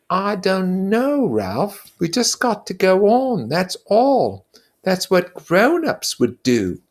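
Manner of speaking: high, earnest schoolboy voice, slowing to insist; a fussy boy pleading for fairness; Piggy's voice. Acted. Piggy answers Ralph.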